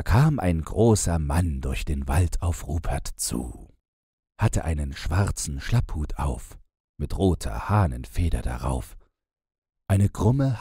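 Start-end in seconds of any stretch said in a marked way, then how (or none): none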